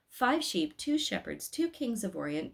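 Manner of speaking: usual speaking voice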